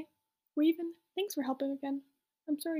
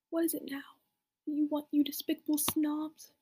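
ordinary delivery

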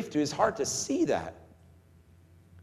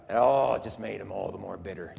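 ordinary talk, nothing marked

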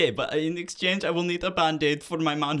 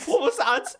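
startled call; 12 semitones higher, 6 dB louder than usual. Acted. Commander startled, calling out.

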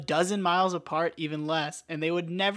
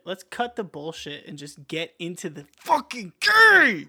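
slow, louder mock-angry snap; 7 dB louder, slower than usual. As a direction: suddenly lose your temper over nothing. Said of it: "I just get really, like, angry".

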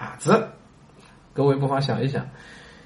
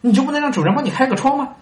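much higher, fast insistent question; the fool's eager push; earnest challenge; 10 semitones higher, faster than usual. Acted. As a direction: press him as if it's obvious. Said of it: "傻子说".

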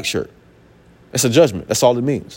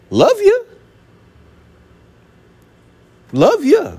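very high, sing-out mock affection; high mock-sweet voice; teasing sweet sign-off; 3 dB louder, slower than usual. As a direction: toss it out high-pitched after a stern warning. Teasing sign-off after "get out.

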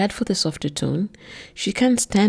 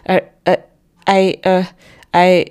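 louder, halting flustered stammer; stunned woman stammering at her accuser; confused stammer; 3 semitones higher, 10 dB louder than usual. A narrator's reading. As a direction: stumble for words in shock. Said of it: "is all Sarah can manage in her confusion".

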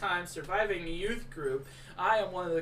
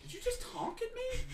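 incredulous; 6 dB quieter than usual. Acted. Pastor incredulous.

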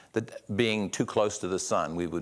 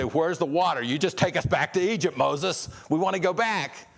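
complaining Israelites; 6 semitones higher than usual. Retold or imitated. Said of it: "And they say".

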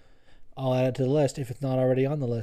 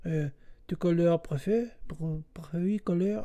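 high, soft, halting schoolboy French; mock French; 4 semitones higher, 4 dB quieter than usual. Podcaster pretending to speak French after a movie, halting and soft. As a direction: fumble through French phrases like a beginner. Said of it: "I wanna fucking learn French".

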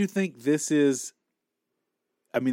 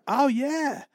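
high pleased germaphobe; 10 semitones higher than usual. Retold or imitated. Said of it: "The germaphobe in me is like".

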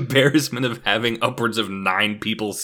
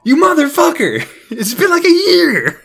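much higher, much louder outraged yell; outraged shout; 11 dB louder than usual. Retold or imitated. Friend confesses after a year, outraged yell. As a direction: scream the betrayal at your friend. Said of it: "And I was like".

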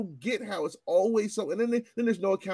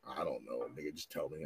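imitating the friend; 15 dB quieter than usual. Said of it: "He's like".

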